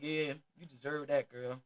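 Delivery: low, smug man's voice; lightly voiced smug man gloating; smug principal. Principal watching her mom slap her, low smug gloat.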